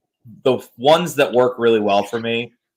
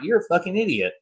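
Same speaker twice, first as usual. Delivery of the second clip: lightly imitated sweet Southern accent, higher pitched; soft Southern accent; 8 semitones higher than usual. Imitating co-host calling people out, soft mock Southern accent. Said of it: "your soft Southern accent, and you're just kinda like".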